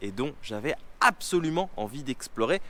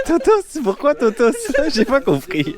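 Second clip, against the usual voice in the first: high, loud laughing question; 12 semitones higher, 11 dB louder than usual. Real, not acted. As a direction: laugh through the words. Laughing at nickname.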